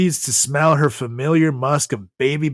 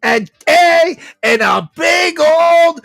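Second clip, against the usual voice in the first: higher, louder grandma voice scolding; grandma shout; 14 semitones higher, 6 dB louder than usual. Acted. Grandma answering her grandson's complaint, loud and high. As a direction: yell it like a pushy old grandma.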